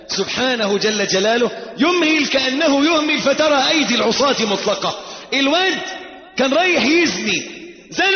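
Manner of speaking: high, driving sermon delivery; full-force preaching. Real, not acted. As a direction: thunder it out to the congregation.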